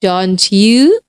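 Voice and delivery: don't you? rising at the end; rising tag. Shown as a demonstration. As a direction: show the tag with an upward tune.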